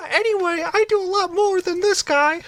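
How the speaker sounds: squeaky Mickey Mouse voice; very high cartoon mouse voice; impression of Kevin. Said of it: "Let's do an impression real quick".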